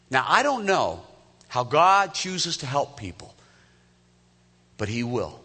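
full-voiced sermon build; rising preaching fervor. Real, not acted. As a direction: drive home the promise to the congregation. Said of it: "preaching climax".

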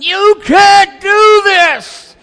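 high, slow screaming rant; retold scream. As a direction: scream it at the driver ahead, drawn out. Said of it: "And I'm screaming it".

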